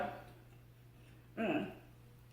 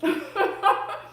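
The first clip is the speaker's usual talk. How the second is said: breathy high laugh; 11 semitones higher than usual. Real, not acted. Laughing at Invisalign story.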